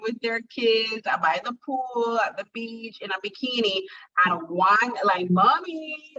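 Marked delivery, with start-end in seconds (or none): 5.3-6.2 lightly voiced child's call, high and drawn out; child calling mommy. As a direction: imitate a kid calling for mother. Child calling mother at pool.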